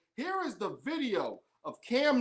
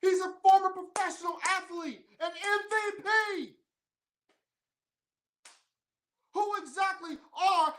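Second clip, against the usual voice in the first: higher, building to a shout; rising anger; 7 semitones higher than usual. Real, not acted. Vlogger defending Cam Newton, building to an angry shout. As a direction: work up to nearly cursing. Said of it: "See, I was about to curse".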